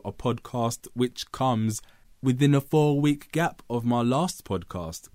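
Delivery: normal talking voice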